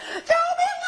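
very high crying shout for help; crying, shrieking plea; desperate scream. Acted. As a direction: scream for your life through tears. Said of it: "拼命高喊".

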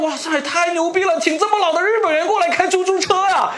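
high, amazed thought, pitch well up; amazed inner voice. Retold or imitated. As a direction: marvel at an old Japanese cabbie. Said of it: "我当时想". (惊叹的内心独白，音调高)

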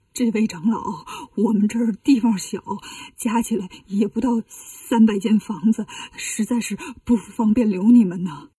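low, halting, quavering monk; trembling fear. Acted. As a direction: plead timidly, faltering between phrases. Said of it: "战战兢兢地说". (战战兢兢地说)

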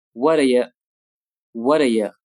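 "What are you" run together and reduced; weak forms. Shown as a demonstration. Teacher shows how "What are you" is reduced. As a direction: swallow "what are you" into one quick unstressed beat. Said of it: "会弱读成".

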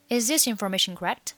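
quick, run-together question; fast demo. Shown as a demonstration. Teacher repeating the linked question at speed, fast. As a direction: rattle it off in one linked breath.

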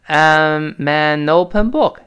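I'm an open book said very slowly, linked; slow linking demo. Shown as a demonstration. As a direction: drag it out, linking every sound. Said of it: "注意，“I am” 还是变成".

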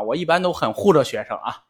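ordinary talk

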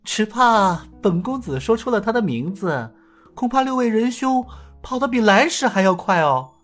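high, teasing, knowing voice; sly smile. Acted. Hero hinting slyly to the six bandits. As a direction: tease with a sly hint. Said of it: "尹建平诡异地笑道".

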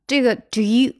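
ordinary talk, nothing marked

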